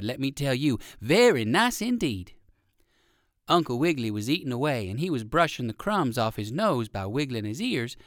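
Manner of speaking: ordinary delivery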